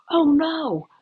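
high dismayed imitation; a high, sinking oh no; imitating Ian. Ian realising who is outside, high and dismayed. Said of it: "He goes".

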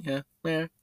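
lightly voiced high cat; cat meow. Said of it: "That's what it's saying".

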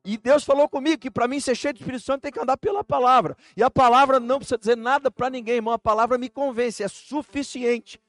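loud, high, driving preaching; preaching at full force. Acted. Pastor telling the church his story, preaching loudly. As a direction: preach it with fire.

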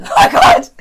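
laughing scream of delight; shrieking laugh. Real, not acted. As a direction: squeal it out laughing.

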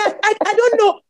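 high, stammering mock helplessness; flustered stammer. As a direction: stutter it out in a retold panic. Retold helpless reply to husband.

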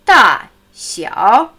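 Da, xiao said loud and clear; Chinese tones demo. Shown as a demonstration. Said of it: "groß, klein". (laut vorgesprochen: Da, xiao)

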